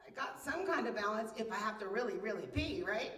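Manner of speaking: ordinary talk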